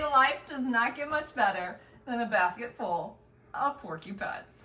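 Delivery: normal talking voice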